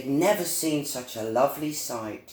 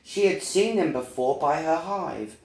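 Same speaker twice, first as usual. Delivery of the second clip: ordinary delivery, nothing marked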